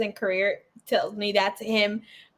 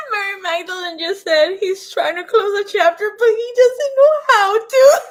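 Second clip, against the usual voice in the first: laughing through tears; 8 semitones higher, 3 dB louder than usual. Real, not acted. Overwhelmed during reading.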